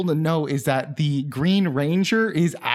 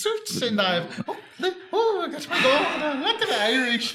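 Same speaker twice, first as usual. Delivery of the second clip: high, laughing Irish leprechaun; 8 semitones higher than usual. Pretend. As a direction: play a giddy leprechaun, way up high. Leprechaun bit, bouncing around.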